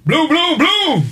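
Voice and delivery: high mimicked bumps down the stairs; imitated tumbling thuds. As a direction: sound out each bump, high and punchy.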